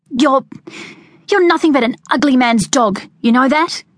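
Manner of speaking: put-on high, stammered childish insult; outraged insult. A narrator's reading. Veronica insulting Jack with her brother's slang, outraged. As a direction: hurl the worst insult she knows. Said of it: "Veronica's outrage".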